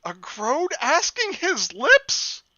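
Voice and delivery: high, slow disbelief; incredulous. Real, not acted. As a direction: stretch it out in disbelief. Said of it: "incredulous reread".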